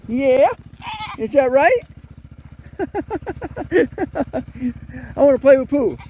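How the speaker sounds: very high, loud cooing pet voice. Real, not acted. Woman greets the baby goat in a high squeal.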